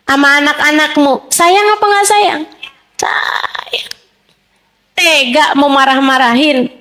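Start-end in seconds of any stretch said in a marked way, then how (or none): 3.0-3.9 whispered answer. Acted. Answering own question to mothers.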